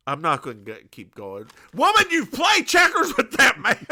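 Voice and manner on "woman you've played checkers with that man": loud, high acted outburst; mock exasperated shout. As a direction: yell it in hammed-up disbelief. Acted exasperated retort to Jess.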